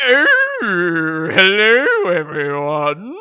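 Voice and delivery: put-on loud, slow wobbly old doctor voice; a quavering public announcement, hammed up; warbling mock official message; Fauci voice clip. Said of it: "Is that what Doctor Fauci sounds like?".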